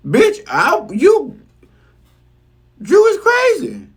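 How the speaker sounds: high mock squeal of disbelief, rising; Sonya's disbelief. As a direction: squeal it like she can't believe her eyes. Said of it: "And even Sonya's like".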